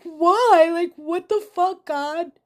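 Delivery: mother cries out to God after crash, furious and anguished; anguished shout at God. Retold or imitated. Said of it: "And I'm like".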